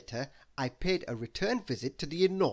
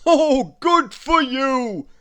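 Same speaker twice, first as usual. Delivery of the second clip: put-on loud, slow delighted old man, pitched up; rumbling Father Christmas; 10 semitones higher, 14 dB louder, much slower than usual. Father Christmas pleased his nephew will help, loud and slow. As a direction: beam with pleasure down the line. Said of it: "the voice rumbled in my ear".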